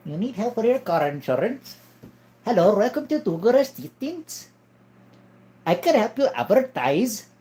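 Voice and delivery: high-pitched put-on Indian accent; Indian-accented helper, pitched well up. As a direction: play an Indian call-centre helper. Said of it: "an Indian guy".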